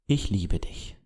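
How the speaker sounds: a quiet, sincere I love you; soft tender confession. Shown as a demonstration. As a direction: say it gently as if to a loved one. Says the phrase as if meant.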